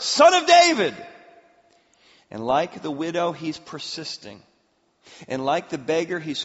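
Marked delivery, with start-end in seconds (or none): 0.0-1.0 emphatic proclamation. Real, not acted. Preacher climaxes on the title.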